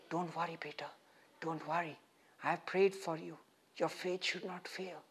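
very soft, hushed comforting voice; tender reassurance. Acted. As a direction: gently plead with a dear friend not to despair, softly. Voices suffering Jesus comforting Peter.

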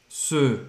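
"Ce" through O-rounded lips. Shown as a demonstration. Teacher alternating the two sounds, rounded E. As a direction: say "Ce" with a closed rounded vowel. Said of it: "Você faz a boca do O".

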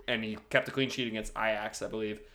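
usual speaking voice

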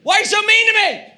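high, loud outburst at wife; mock-wounded high-pitched yell; hammed-up hangry shouting. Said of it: "I start yelling at Kate".

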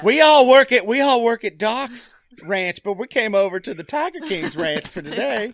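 high exaggerated voice, settling as it goes; Dwight impression. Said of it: "what is that accent".

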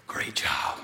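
whispered praise, barely voiced; hushed. Coach whispering praise to his player up close. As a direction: breathe it so only two boys hear. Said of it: "in a voice only me and Rodney could hear".

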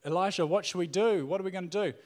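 lightly acted frantic pleading; freaking out. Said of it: "he's like freaking out".

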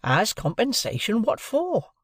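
high, slow, drawn-out demand. A narrator's reading. Joe demands an explanation from the lawyer, demanding. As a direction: voice pitched well up, insisting on an answer. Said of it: "Joe demanded".

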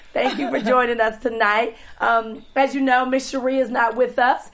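usual speaking voice

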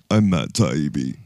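low-pitched impression of a man's voice; Matt Taibbi. Host announcing their best impression, mimicking him low. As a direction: drop the voice low for a bad impression. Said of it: "do my best Matt Taibbi impression".

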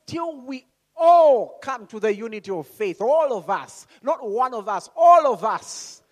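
loud, high punch on "all"; emphatic insistence. Real, not acted. Preacher insisting every believer is included, emphatic. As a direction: hit "All of us" hard, stretching the last one.

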